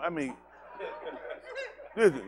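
normal talking voice

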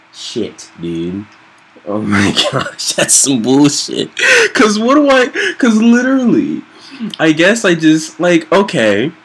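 normal talking voice

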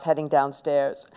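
ordinary delivery, nothing marked

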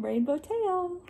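rainbow tail lifted high; playful reveal. A narrator's reading. Reading to children, playful lifted finale. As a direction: end on a bright surprise.

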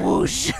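breathy whoosh of a swinging axe. Pretend. Player mimes an axe swing, one whispered whoosh. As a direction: blow out one swing sound. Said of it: "I was thinking fantasy R P G".

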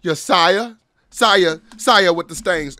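loud mocking courtier call; mock royal call. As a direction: bellow sire like a herald. Mocking the clip.

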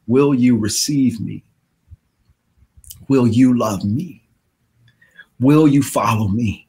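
low, soft, pleading; Jesus inviting the listener; gentle invitation. Acted. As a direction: ask softly.

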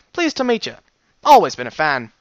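excited fan. Acted.

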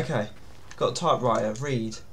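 ordinary delivery

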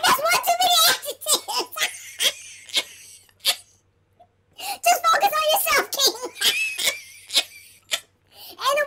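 crying with laughter; talking through tears of laughter; laughing hard. Real, not acted. Cracking up after a long laugh.

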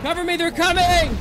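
high, loud panicked yell; cover me shout. Real, not acted. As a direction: scream for backup. Calling for cover under attack.

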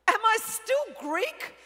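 high, slow, loud disbelieving cry; stunned question. Retold or imitated. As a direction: blurt it out in shock, drawn out. Stunned daughter's first question.

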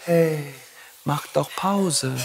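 soft, very slow angelic coaxing; whisper-sweet temptation to quit. Retold or imitated. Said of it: "mit Engelstimme sagt". (sanfte Engelsstimme, leise und sehr langsam)

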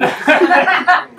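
real high laugh. Real, not acted. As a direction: break up laughing. Narrator laughs.

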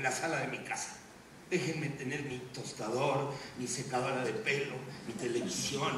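low, very soft monologue; subdued resignation. Acted. Anchor pleading to be left in peace, subdued. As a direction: speak low and hushed, as if resigned. Weary speech before the angry outburst.

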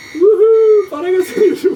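loud, high whoop of joy; excited cheer. Real, not acted. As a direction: cheer it out gleefully. Celebrating while cutting hair. (sevinçle bağırarak)

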